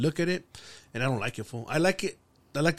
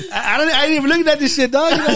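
high, loud protest through laughter; laughing protest; 11 semitones higher, 10 dB louder than usual. Real, not acted. Defending his algorithm to teasing friends, laughing protest. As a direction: laugh hard while trying to deny it.

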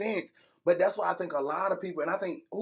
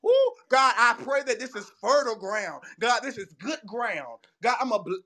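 fervent preacher prayer; 5 semitones higher than usual. Pretend. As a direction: pray heaven down with fervor. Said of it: "I said".

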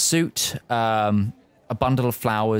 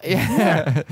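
ordinary delivery

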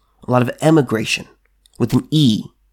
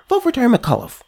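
a much higher, mocking "vote" that falls; sarcastic; 11 semitones higher than usual. Real, not acted. Telling Virginians to vote McAuliffe, in sarcastic mockery. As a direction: sneer the endorsement. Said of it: "repeated with contrast".

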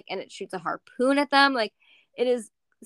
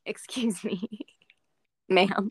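ladybug voice; slower than usual. Retold or imitated. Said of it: "Yeah, like".

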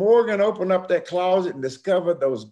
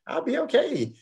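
much higher, squeaky insistence; rising panic; 11 semitones higher than usual. Retold or imitated. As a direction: talk yourself into it, higher each time. Escalating nervous self-assurance.

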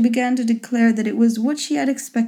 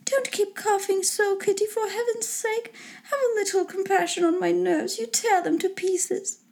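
high, soft, long-suffering complaint; fretful mother with frayed nerves, pitched very high; scolding mother; 10 semitones higher, 5 dB quieter than usual. A narrator's reading. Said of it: "began scolding one of her daughters".

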